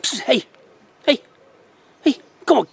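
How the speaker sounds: sharp high calls to a wandering dog; calling the dog. Real, not acted. Forager calls his dog away from sniffing, loud and high. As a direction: psst then hey, hey, to grab the dog's attention.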